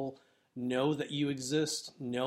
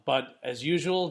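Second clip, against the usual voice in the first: normal talking voice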